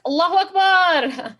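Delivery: loud slow proclamation; mock shout. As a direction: call it out slow and loud to provoke. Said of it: "I was like".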